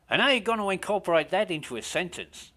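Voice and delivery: eager Australian DJ pressing on; Australian accent. Retold or imitated. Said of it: "my Australian accent's shit".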